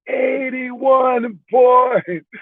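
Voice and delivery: high, hugely drawn-out mock shout; imitating a Lithuanian guy's triumphant cry; excited Lithuanian guy. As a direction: announce it in stretched-out high excitement. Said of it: "And he goes".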